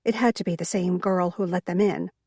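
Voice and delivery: ordinary delivery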